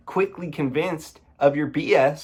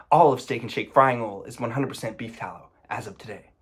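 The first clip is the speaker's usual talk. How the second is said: normal talking voice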